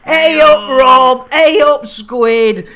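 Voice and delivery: loud, high cheery hello; loud greeting. Real, not acted. Streamer loudly greeting viewers Rob and Squid, calling out. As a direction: call out a cheery welcome.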